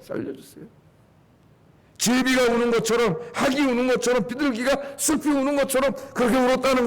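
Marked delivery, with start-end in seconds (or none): 0.0-0.6 breathless whispered begging; whispered plea. Shown as a demonstration. Preacher showing the king's prayer as a faint whisper. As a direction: whisper it like a dying man. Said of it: "이렇게".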